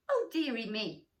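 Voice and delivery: ordinary delivery, nothing marked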